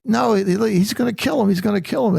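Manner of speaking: put-on high, insistent child's voice; insistent little boy. Grandson insisting Goliath will kill David, high and insistent. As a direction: insist like a worried kid.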